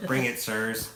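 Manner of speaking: put-on high, cocky twelve-year-old voice with a laugh; playful high dare from a young toymaker; cocky kid challenge. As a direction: taunt them like a cheeky kid. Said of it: "pulls out two toy making tools and goes".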